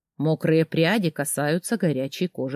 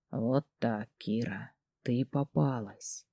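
low, much softer, exhaled; breathy exhale; 6 semitones lower, 12 dB quieter, slower than usual. Acted. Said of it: "выдохнул дракон мне в губы".

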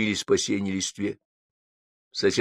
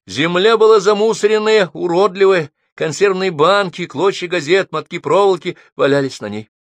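reading with excitement; 9 semitones higher, 7 dB louder than usual. A narrator's reading. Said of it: "стала читать взволнованно".

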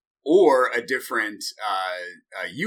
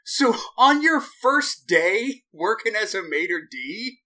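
ordinary talk

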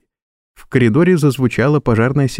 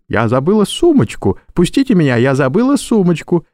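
higher, panicked woman calling out; shouting woman; 6 semitones higher than usual. Acted. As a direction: cry out as a frantic woman. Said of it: "кричала какая-то женщина".

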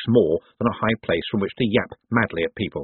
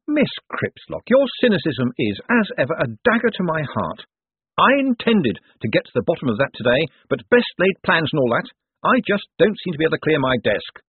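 higher voice, theatrical reply to a reporter; showman Moist, mock-wounded; 7 semitones higher than usual. A narrator's reading. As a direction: plead lightly, playing to the crowd. Moist performs for crowd.

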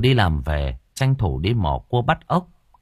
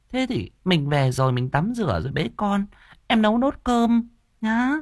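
high, glib, sugary excuses; sly sharp-tongued stepmother; 9 semitones higher than usual. Acted. Stepmother lying to her husband about the crying boy, high glib excuses. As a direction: brush it off sweetly with a false high voice. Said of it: "nói bằng cái giọng đanh đảnh".

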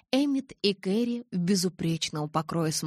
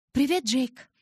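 high, louder cheerful hello; pitched-up, friendly welcome; smiling greeting; 8 semitones higher, 5 dB louder, slower than usual. A narrator's reading. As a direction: greet a friend with an audible smile. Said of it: "улыбнулась я".

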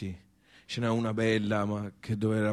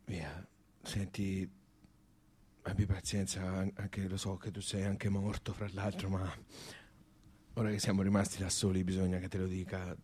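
hushed confidence; 9 dB quieter than usual. Acted. Alone with the dead friend, hushed. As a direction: lean in and confide quietly. Speaking privately to the dead man.

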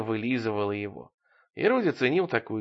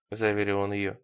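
plain reading voice

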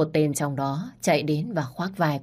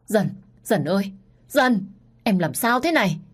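frightened calls, rising higher and louder; panicked calling; 4 semitones higher, 4 dB louder than usual. A narrator's reading. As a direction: shake her and call her name in panic. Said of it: "Cậu hai hoảng sợ gọi tôi". (hoảng hốt gọi tên Dần)

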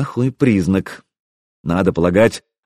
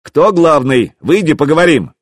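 loud, raised challenge; calling out; 5 semitones higher, 4 dB louder than usual. A narrator's reading. Enemy prince demanding a parley, calling out. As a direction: call up to the people inside.

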